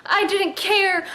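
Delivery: usual speaking voice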